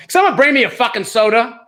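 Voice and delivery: comic sudden outburst from a star; brusque order. Acted. Star ordering the assistant about, loud and high. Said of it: "turns to my assistant and goes".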